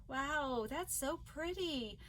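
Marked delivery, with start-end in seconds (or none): none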